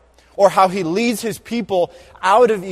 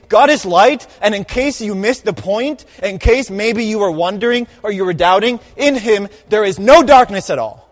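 louder, higher insistence, punch "no darkness"; emphatic retelling; 4 semitones higher, 6 dB louder than usual. Retold or imitated. Preacher voicing John making it clear, emphatic. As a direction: drive the point home as if John insists. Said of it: "it's as if John is saying".

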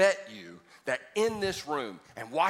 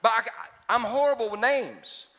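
higher and louder, building up laughs; excited storytelling; 6 semitones higher, 5 dB louder than usual. Real, not acted. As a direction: get fired up telling the story. Preacher getting louder with a funny story.

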